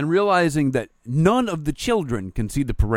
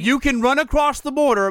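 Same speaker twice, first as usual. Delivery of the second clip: high, loud indignation; mock outraged rant; 12 semitones higher, 6 dB louder than usual. Driver ranting at someone strolling across the street, outraged. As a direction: rail at them, pitched way up.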